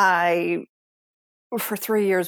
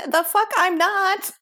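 much higher and louder, slow and drawn out; defiant retort; 13 semitones higher, 6 dB louder than usual. Retold or imitated. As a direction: fire it back at the husband, slow and loud. Defying husband about swearing.

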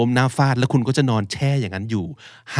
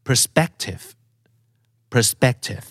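perspective with SPEC stressed; stressed syllable demo; much slower than usual. Shown as a demonstration. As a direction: hit the middle of perspective, per-SPEC-tive. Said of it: "เน้นที่พยางค์ไหนครับ".

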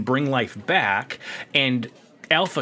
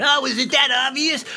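put-on much higher, easygoing Californian accent; 11 semitones higher than usual. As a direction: play it like a laid-back Californian. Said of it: "He sounds like a Californian".

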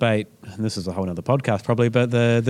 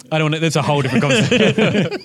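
laughing; 4 semitones higher, 4 dB louder than usual. Real, not acted. Laughing off reggae tangent.

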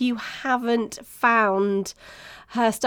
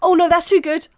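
higher, louder mock protest; mock alarm; 7 semitones higher, 6 dB louder than usual. Host voices life halting a good run, mock alarm. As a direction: call a halt in mock alarm, drawing out the last words.

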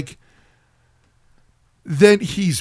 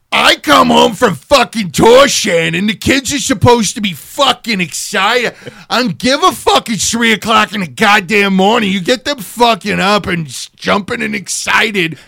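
ranting, much higher and louder; angry husband; 8 semitones higher, 8 dB louder than usual. Retold or imitated. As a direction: yell it like a spoiled man. Angry husband yelling at wife.

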